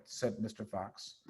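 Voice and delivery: usual speaking voice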